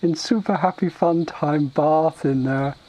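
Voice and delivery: high, slow, halting ogre voice; simple-minded ogre, explaining. Acted. Ogre telling the Tauren about the cave, plodding. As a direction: search for each word like a dim ogre.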